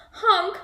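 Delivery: loud high honk; scared honk. Acted. Dump truck stuck in the mud, a loud high honk. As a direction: honk for help. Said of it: "cried the dump ... sounded scared".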